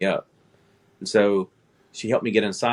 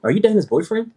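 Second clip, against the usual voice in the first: startled recognition; 11 semitones higher, 6 dB louder than usual. Retold or imitated. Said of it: "And I went".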